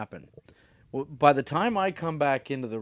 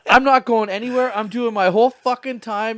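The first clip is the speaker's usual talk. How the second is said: furious outburst; 8 semitones higher, 8 dB louder than usual. Retold or imitated. Comedian turning on a hostile crowd, mock furious. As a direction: blow up at the crowd like you've snapped. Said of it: "And he fucking snapped".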